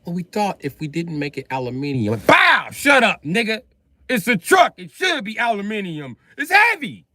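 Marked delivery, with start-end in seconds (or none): 2.3-7.1 high, loud shouting boss; mock angry yell. Boss yelling at the truck designer, mock angry. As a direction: blow up at the engineer. Acted boss outburst at engineer.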